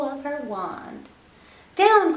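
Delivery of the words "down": sharp loud high "Down!"; witch crying out. Acted. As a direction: call the broom down urgently. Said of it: "cried the witch".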